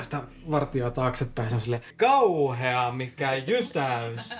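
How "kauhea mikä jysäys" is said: big, drawn-out emphasis; dramatic boom. Retold or imitated. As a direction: stretch it out like a huge blast. Describing the spell's huge blast. (dramaattisesti venytetty)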